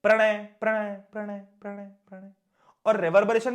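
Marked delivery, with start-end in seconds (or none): 0.0-2.3 the name repeated, fading into the distance; echo. Shown as a demonstration. Teacher showing students what an echo is, fading repeats. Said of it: "एको क्या होता है?".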